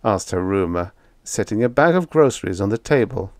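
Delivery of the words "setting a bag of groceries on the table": ordinary delivery, nothing marked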